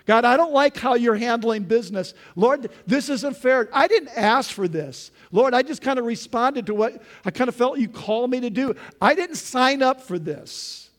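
loud, high, indignant complaint; griping at God. Retold or imitated. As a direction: scold God like a bad boss. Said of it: "a very bad performance review".

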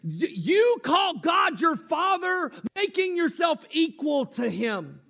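religious leaders shouting in outrage; angry accusation. Retold or imitated. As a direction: hurl the charge in outrage. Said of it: "incensed and angry".

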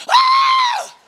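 very high loud demo shriek, half laughing; scream of fear. Shown as a demonstration. Preacher demonstrating the Greek word, screaming. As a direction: show the Greek cry as a scream. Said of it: "In the Greek, it means".

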